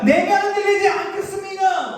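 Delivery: shouting bravely while shaken inside; defiant proclamation. Retold or imitated. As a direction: cry it out as if to convince yourself. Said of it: "왜 이렇게 외치는가".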